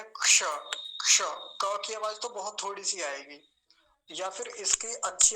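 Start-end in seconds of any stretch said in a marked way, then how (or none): 0.0-0.4 loud, crisp क्ष; clear ksha. Shown as a demonstration. Teacher demonstrates क्ष loudly to the class. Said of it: "इसका उच्चारण कैसे करेंगे".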